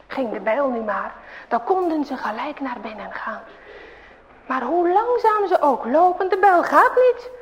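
ordinary delivery, nothing marked

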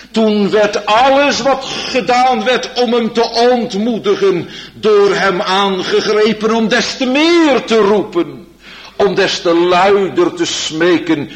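loud, high pleading preaching; fervent preaching. Acted. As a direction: build to an urgent plea. Preaching at full force. (vurig en luid preken)

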